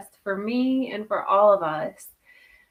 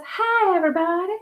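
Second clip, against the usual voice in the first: imitating Marv's greeting, pitched higher; a lightly voiced high bubbly hello; high cheery greeting; 10 semitones higher than usual. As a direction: sing out a bright mock hello. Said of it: "that's how I say he says it".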